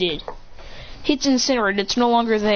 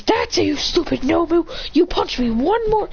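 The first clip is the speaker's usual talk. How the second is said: angry threat; 6 semitones higher than usual. Acted. Character voiced angrily in story.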